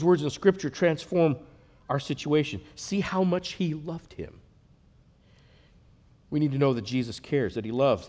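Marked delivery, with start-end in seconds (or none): none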